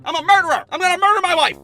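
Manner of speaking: high, loud hammed-up murderer; murderer impersonation. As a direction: play the intruder badly on purpose. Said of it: "my best murderer impersonation".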